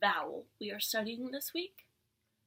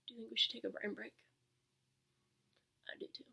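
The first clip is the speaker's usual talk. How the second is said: whispering; 12 dB quieter, faster than usual. Acted. Teacher suggests a brain break.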